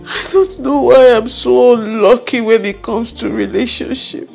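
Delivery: sobbing, wounded lament; tearful self-doubt, heartbroken; crying. Acted. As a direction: cry through the words, breath catching. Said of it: "you're here crying over him".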